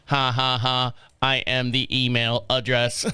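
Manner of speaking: low robot with mechanical laugh. Pretend. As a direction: drone it in measured robot syllables. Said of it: "All right there, robot".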